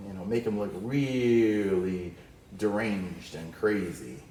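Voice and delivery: normal talking voice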